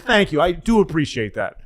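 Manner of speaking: high, loud, touched thanks; grateful staffer. Retold or imitated. Staffer thanks the caller for his kind wish. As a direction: thank him like a kindness finally came. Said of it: "He said".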